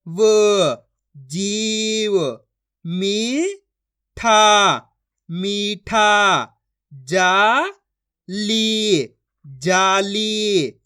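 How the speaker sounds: each syllable stretched long and held; very slow syllable-by-syllable reading demo; drawn-out pronunciation. Shown as a demonstration. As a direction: draw out every vowel like a reading teacher. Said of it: "खींच खींच के".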